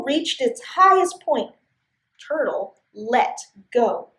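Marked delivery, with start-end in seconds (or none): none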